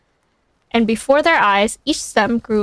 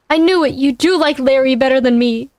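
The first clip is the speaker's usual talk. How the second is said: jealous outburst; 3 semitones higher, 3 dB louder than usual. Acted. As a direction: blurt it out like a hurt, jealous kid. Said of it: "he cried".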